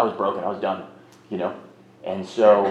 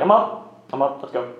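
startled answer; 4 semitones higher, 4 dB louder than usual. Retold or imitated. Jolted awake by angry mom.

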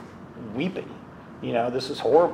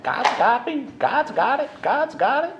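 much higher imitation of his mother, repeating it; mimicking mom; 12 semitones higher, slower than usual. Son mimics his mother brushing off his worry, high-voiced. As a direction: mimic mom's breezy faith in a high voice. Son retells mom's reply.

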